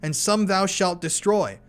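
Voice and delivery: high priest answering the mocking spirit, proclaiming; high priest's declaration. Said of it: "then speaks up over the voice".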